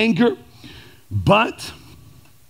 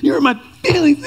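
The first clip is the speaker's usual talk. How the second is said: put-on toddler voice; 9 semitones higher than usual. Imitating two-year-old daughter whining at her parents. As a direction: whine it like a hurt toddler. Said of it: "It was just like".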